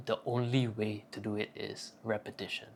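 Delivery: usual speaking voice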